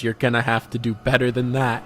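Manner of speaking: ordinary delivery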